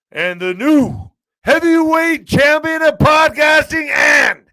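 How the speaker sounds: booming high boxing-announcer shout, stretched out slowly; ring announcer call. Pretend. Closing his stream by announcing himself as champion, booming announcer shout. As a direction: crown yourself like the fight-night MC.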